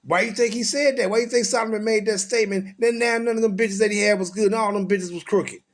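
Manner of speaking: ordinary talk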